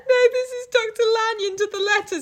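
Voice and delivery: ordinary talk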